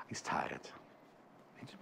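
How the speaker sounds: hushed aside whisper. Real, not acted. Presenter teasing tired co-host, whispered aside. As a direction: whisper it behind your hand.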